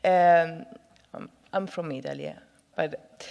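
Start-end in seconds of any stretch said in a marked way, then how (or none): none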